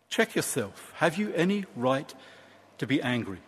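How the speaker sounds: subdued, kindly challenge; gentle reproof. Acted. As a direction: quietly urge him to think twice. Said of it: "he says it very gently".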